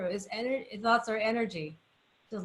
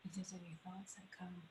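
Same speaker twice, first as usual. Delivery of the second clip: hushed guidance; 2 semitones lower, 23 dB quieter, faster than usual. Acted. Co-host guiding the group's relaxation.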